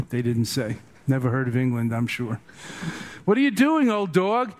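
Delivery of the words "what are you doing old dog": high, loud jeering cry; mock shout. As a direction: holler at the old dog from across the clearing. Monks mock naked teacher.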